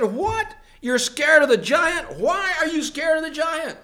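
put-on high-pitched disbelieving shepherd boy; incredulous. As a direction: ask in astonishment, pitched right up. Shepherd boy questioning frightened brothers.

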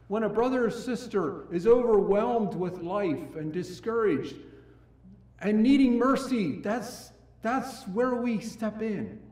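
loud, high, urgent sermon voice; impassioned preaching. Acted. Pastor urging ministers to show mercy, impassioned loud preaching. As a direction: press the point on the brothers with fire.

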